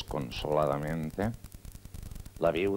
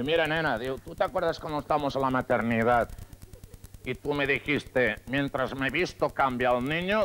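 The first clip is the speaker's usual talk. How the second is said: voz alzada del marido; 6 semitones higher, 5 dB louder than usual. Acted. Marido confiesa en discusión.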